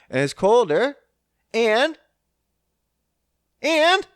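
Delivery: getting heated, voice climbing; exasperated outburst building; worked up. Real, not acted. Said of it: "I'm getting a little worked up here".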